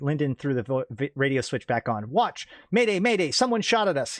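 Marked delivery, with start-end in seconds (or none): 2.1-4.2 yelled. Acted. Pilot yells to the watch after being shot at, panicked yell. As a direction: shout it into the radio in panic. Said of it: "he yelled".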